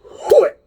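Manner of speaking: sudden high yelped whoop; mock whoop. Masseuse whooping as she strikes his back, sharp high yelp. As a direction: imitate her sharp loud whoop. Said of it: "all of a sudden she goes".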